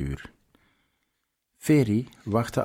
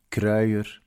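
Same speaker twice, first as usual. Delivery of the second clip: plain reading voice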